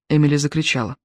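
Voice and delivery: ordinary delivery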